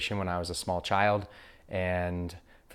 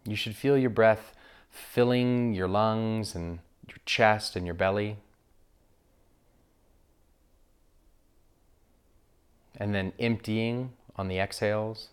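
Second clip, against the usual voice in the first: guided meditation. Acted. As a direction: guide listeners gently into breath awareness, unhurried.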